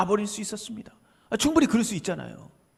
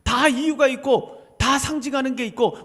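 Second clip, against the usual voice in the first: ordinary talk, nothing marked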